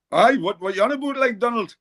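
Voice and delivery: high-pitched broad North East voice; broad local accent. Retold or imitated. Local visitor answering Donald Trump, broad North East accent. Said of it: "can't understand your accent".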